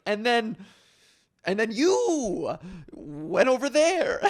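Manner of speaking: loud, high, slow stalling voice; making it up as you go; floundering detective voice. Retold or imitated. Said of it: "You'd be like".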